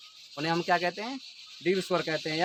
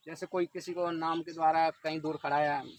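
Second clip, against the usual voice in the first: ordinary talk, nothing marked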